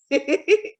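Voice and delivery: put-on high little-boy giggle; child giggle. A narrator's reading. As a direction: giggle delighted at the silly names. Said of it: "Frederick giggled at the names".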